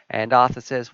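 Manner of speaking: ordinary talk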